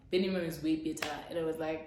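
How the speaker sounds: ordinary talk, nothing marked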